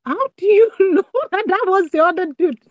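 high-pitched, laughing disbelief; mock-amazed question with a laugh; incredulous. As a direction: act out a baffled reaction, pitch jumping up. Acting out own amazed reaction.

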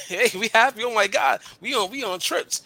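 high, loud gushing over the relationship; mock happy couple. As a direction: gush like a couple showing off. Voicing happy couple in collage.

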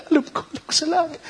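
high, laughing "alam ko, alam ko"; mock Martha's reply. Preacher voicing Martha with a high laughing brush-off. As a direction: play her brushing it off, laughing.

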